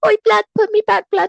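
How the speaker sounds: very high mock baby roadman; squeaked-up roadman baby, put on; newborn roadman. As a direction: protest like a street-talking newborn. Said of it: "he comes out the womb talking".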